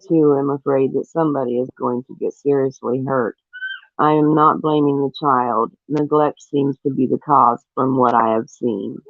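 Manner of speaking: normal talking voice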